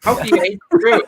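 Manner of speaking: high, laughing "how can you"; laughing disbelief. Retold or imitated. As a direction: laugh through the question in mock disbelief. Retelling disbelief at a friend.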